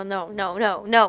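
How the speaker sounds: usual speaking voice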